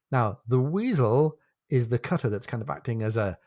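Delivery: normal talking voice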